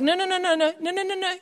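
a run of high, quick no's; insistent no. Acted. Preacher refusing to let a bad day win, insistent.

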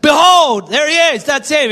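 loud, high cry of a wild desert prophet; John the Baptist pointing and crying out. Pretend. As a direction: shout it out like a wild-haired prophet. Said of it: "like John the Baptist, wild, dreadlocks".

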